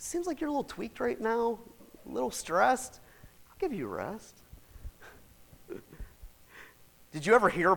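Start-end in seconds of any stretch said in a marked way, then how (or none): 3.5-4.4 much softer, gentle reassurance; soft promise. Acted. Preacher repeats God's promise to the stressed, softly. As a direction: say it gently, as comfort.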